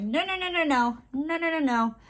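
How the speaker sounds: high playful string of no's; retold protest. Retelling refusing to call the niece Thing, high playful no. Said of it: "And I'm like".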